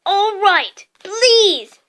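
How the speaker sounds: high, loud giving in; fed-up yell; exasperated. A narrator's reading. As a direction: shout the please grudgingly. Filch gives in, exasperated.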